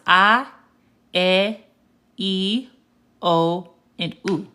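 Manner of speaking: "A, E, I, O, U" as pure Spanish ah, eh, ee, oh, oo; Spanish vowel sounds. Shown as a demonstration. Teacher models the five vowels for learners, pure Spanish sounds of A, E, I, O, U. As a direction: separate each Spanish vowel, A E I O U, with pauses. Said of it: "So it's pronounced".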